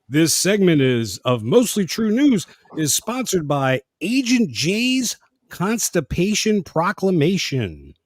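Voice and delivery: hammed-up commercial voice; slow, grand mock sponsor read.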